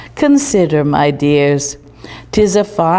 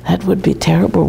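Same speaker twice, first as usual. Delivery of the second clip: usual speaking voice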